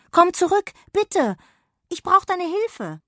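high, loud cry for help; stuck and pleading, shout it; calling for help. Acted. Jonna stuck in the hole, shouting for help. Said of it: "Sie rief nach Sausewind".

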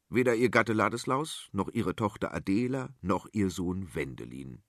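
usual speaking voice

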